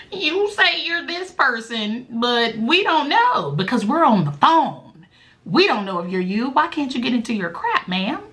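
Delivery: mocking a suspicious support agent; skeptical agent.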